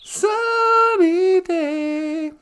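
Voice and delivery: very slow, drawn-out sing-song; slowly mocking a fireworks lover; mock dreamy voice. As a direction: savour it like a dopey fan.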